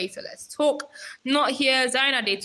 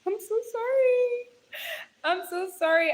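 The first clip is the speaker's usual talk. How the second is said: giggly apology; 12 semitones higher than usual. Real, not acted. Streamer apologising after laughing at a comment, giggling. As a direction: squeak the sorry through the giggles.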